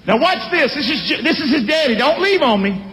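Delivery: ordinary talk, nothing marked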